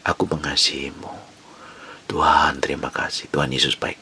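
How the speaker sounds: whispering to God while driving; Indonesian: doa berbisik; whispered prayer. Shown as a demonstration. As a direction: breathe the words out softly.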